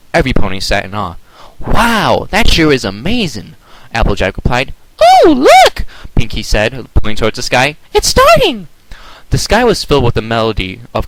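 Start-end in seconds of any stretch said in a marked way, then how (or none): 1.6-3.5 higher awed reply; Applejack amazed. A narrator's reading. Applejack awed at the story, higher louder. 5.0-5.8 very high, loud squeal; Pinkie excited. A narrator's reading. Pinkie seeing the shower start, very high squeal. 7.9-8.7 very high, loud squeal; Pinkie excited. A narrator's reading. Pinkie seeing the shower start, very high squeal.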